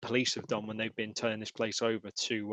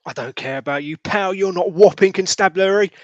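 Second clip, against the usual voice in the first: louder, higher, scornful snarl; defiant yell; 8 semitones higher, 12 dB louder than usual. Acted. Constable defying the sergeant holding him, loud and scornful. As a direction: spit it back at the sergeant.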